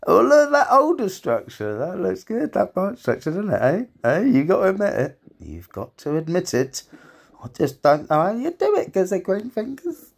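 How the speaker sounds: high, loud, boastful voice; showing off. Pretend. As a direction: brag about it in a high voice. Boastful high self-praise.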